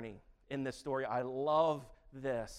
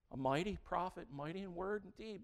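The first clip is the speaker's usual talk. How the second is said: ordinary talk, nothing marked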